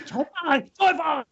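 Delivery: high-pitched mock Chinese accent. Host repeating restaurant name while driving, mock Chinese accent. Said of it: "you have to say it in an".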